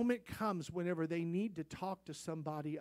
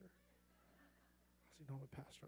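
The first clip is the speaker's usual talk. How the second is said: slow, breathy whisper to himself; a hushed, puzzled aside to himself; whispered thought; 4 semitones lower, 23 dB quieter than usual. Retold or imitated. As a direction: whisper the question under your breath. Private thought, repeated twice.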